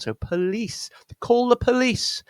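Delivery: police with a schwa first syllable, stress on lee; schwa then lee. Shown as a demonstration. Teacher drilling police in a phrase. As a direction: call the police, hitting lee. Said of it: "which is a schwa sound".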